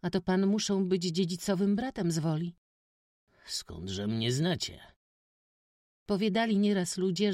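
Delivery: put-on high, loud boyish chatter; eager village boy. A narrator's reading. Witek excitedly tells the stranger village gossip, high boy voice. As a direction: tell it all in a rush.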